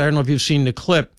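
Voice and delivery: ordinary talk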